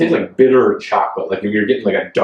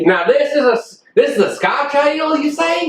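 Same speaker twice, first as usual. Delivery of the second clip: a much higher, hammed-up Scottish brogue; mock Scottish accent, high; 13 semitones higher than usual. Mocking a friend's accent, a high hammed-up Scottish brogue. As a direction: squeak out a bad Scottish accent. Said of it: "Is that how you talk Scottish?".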